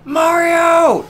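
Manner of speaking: urgent high shout of Mario; offscreen shout. Retold or imitated. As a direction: yell for Mario from off screen. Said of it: "another off-screen voice".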